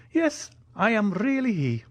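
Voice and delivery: high-pitched, delighted confirmation; joyful. A narrator's reading. Italian confirms his identity to the Count, joyfully. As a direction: confirm it gladly in the old major's high voice. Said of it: "joyfully replied the Italian".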